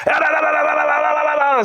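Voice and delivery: very high screamed gibberish; rapid-fire mock screaming on nonsense syllables; drill instructors yelling. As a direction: imitate a barrage of shouted orders. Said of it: "start yelling at everybody".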